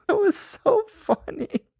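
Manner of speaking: very high, squeaky laughing voice; slow words squeezed out through real laughter; helpless laughter. Real, not acted. Cracking up after the imitation.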